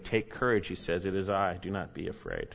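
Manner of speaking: soft, low comforting; quiet words to calm a fearful heart; tender reassurance. Acted. Pastor quoting Jesus to suffering listeners, softly tender.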